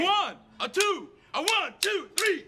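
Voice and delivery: count-off. Acted. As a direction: count the band in brightly. Band count-off.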